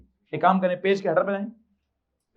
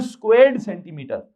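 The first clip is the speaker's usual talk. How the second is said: Squared stretched and prominent; stress on squared; 4 dB louder than usual. Shown as a demonstration. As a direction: lean hard on squared, falling on centimetre. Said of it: "इसलिए इसको पढ़ते हैं". (Squared को खींचकर ज़ोर से)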